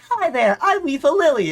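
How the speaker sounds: very high, loud chirpy robot greeting. Retold or imitated. Newly built robot greeting the visitors, hammed-up. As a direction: introduce yourself like a cheery new robot. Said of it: "the robot stands up like".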